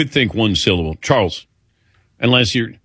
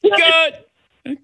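mock cheer. Real, not acted. Host reacts with mock cheer.

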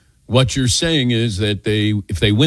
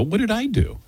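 high, startled "what did I do"; mock shocked question; 10 semitones higher, 4 dB quieter than usual. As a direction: play the wronged innocent, pitch jumping up. Retold shocked question to Mark.

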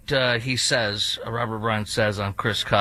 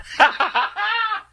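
high, squealing burst of laughter; high-pitched laugh. Real, not acted. Host cracking up at his horrible impersonation, high laugh.

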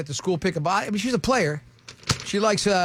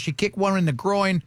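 ordinary delivery

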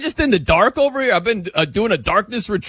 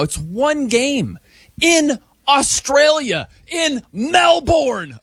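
"Australia" and "Melbourne" high and drawn out; exasperated emphasis; 6 semitones higher, much slower than usual. Real, not acted. Host arguing against overreaction, exasperated drawn-out emphasis. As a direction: punch "Melbourne" as a slow, high mel-BORN.

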